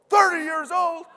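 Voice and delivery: high, loud, incredulous squawk; shocked disbelief. Retold or imitated. Coach hears the brother is thirty, shouting in high disbelief. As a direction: blurt it out in total disbelief, way up high.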